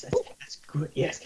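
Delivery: soft whispered reply; whispering. Acted. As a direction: whisper back to keep quiet. Healer whispers back.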